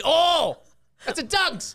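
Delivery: high, loud thrilled outburst; mock excited exclamation. Mimicking viewer who spots a Dunks, mock excitement. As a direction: cry out like a delighted local.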